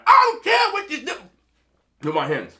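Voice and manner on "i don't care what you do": high, loud comedian's rant; exaggerated comic yelp, pitched way up; Chris Rock. Ranting at a partner about what they do, Chris Rock impression. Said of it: "I feel like Chris Rock right now".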